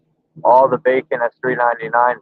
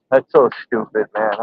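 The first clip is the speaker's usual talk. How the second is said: ordinary talk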